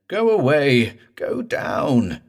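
high, loud desperate begging; thick-voiced plea. A narrator's reading. Morse ordering the corpse back under, thick desperate plea. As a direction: urge it away in desperation. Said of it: "Morse said thickly, and again".

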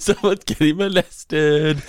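very slow, high, stretched words; hammed-up promo sing-out; echoed mock tagline. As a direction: draw out every word high.

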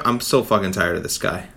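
usual speaking voice

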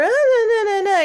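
vocal play. Shown as a demonstration. Parent babbling back and forth with baby. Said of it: "doing that vocal play".

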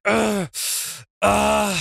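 high pained groans. Acted. Wrestler caught in an ankle lock, groaning in pain. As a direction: groan as the hold bites. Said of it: "Dom groans in pain".

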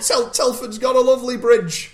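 very high, loud squeaky impression; mock Jordan voice. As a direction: pipe it up like a silly cartoon Jordan. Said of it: "continues squeaky Jordan impression".

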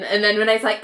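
ordinary delivery

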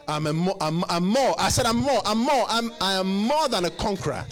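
loud, high proclamation of victory; shouted declaration. Acted. Preacher shouting his victory to the congregation. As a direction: declare it at full voice.

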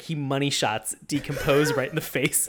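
ordinary talk

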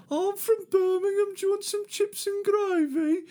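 very high-pitched cartoon Birmingham voice; mock Birmingham accent. Teasing his girlfriend about where she's from, mock Birmingham accent. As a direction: tease with an exaggerated, squeaky Brummie accent. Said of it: "She's from Birmingham kind of area".

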